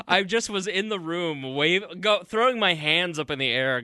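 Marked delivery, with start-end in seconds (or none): none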